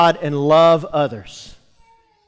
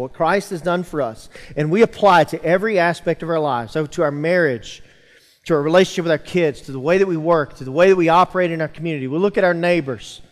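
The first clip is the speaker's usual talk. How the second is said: normal talking voice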